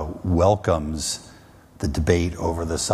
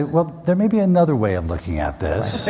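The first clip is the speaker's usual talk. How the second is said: higher, polite hedge, tongue in cheek; diplomatic understatement; 7 semitones higher, 4 dB louder than usual. Retold or imitated. Advising the president, a polite hedge with a wink. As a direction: soften it with mock diplomacy. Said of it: "I say".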